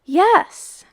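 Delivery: loud, high yes; a loud triumphant cry. Acted. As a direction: burst out in triumph. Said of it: "cried the dark man".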